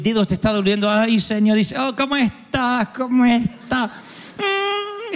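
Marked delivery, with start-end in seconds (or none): 1.8-5.1 pained greeting. Retold or imitated. Saludo con dolor de pie.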